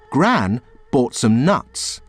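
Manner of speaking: plain reading voice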